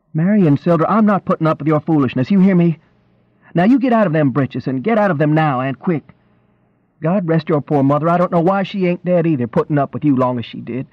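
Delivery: high-pitched exasperated country tirade; fed-up rural wife scolding; angry scolding wife. A narrator's reading. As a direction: lay down the law to a foolish husband.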